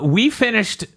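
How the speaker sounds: ordinary delivery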